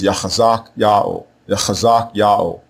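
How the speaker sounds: Yachazak Ya'o as yah-khah-ZAHK YAH-oh; throaty KH inside Yachazak, then Ya'o; correct pronunciation. Shown as a demonstration. Teacher models the third spelling, Yachazak Ya'o as yah-khah-ZAHK YAH-oh. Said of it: "the way you pronounce it is".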